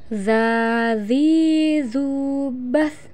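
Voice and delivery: slow drawn-out chant; chanted pronunciation drill; swung syllables. Shown as a demonstration. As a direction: stretch each vowel, hold the last consonant. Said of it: "ayu ayun mana tahan".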